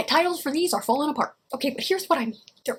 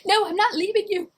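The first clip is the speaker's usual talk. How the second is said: higher, mock dramatic refusal; loyal comrade; 8 semitones higher than usual. To an injured friend in battle, hammed-up. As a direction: refuse to go with fake heroics. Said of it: "the other one says".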